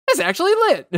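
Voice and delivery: high-pitched excited squeal; self-imitation. Streamer imitates own win reaction, high excited squeal. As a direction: squeak it out in mock amazement. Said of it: "me literally being like".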